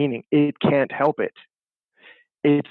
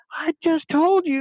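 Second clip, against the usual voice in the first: high, drawn-out exasperated protest; exasperated husband; 14 semitones higher than usual. Retold or imitated. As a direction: act out a husband at the end of his patience. Husband snapping in acted quarrel.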